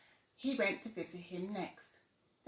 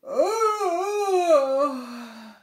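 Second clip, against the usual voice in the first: long, loud, high yawn; big yawn; 8 semitones higher, 14 dB louder than usual. Acted. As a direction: stretch out a huge yawn. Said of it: "yawned Little Tiger".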